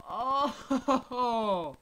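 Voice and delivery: loud high burst; surprised shout. Real, not acted. Streamer reacts to the kill, shocked yell. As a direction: shout it out, startled.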